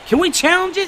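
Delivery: high, disbelieving cry; incredulous. Real, not acted. Gamer protesting a call he can't believe, up high. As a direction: pitch it up in disbelief.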